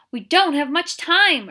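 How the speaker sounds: loud, high, urgent. A narrator's reading. Catherine urging the sheriff, loud and high. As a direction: press him hard to act now. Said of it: "urged Catherine".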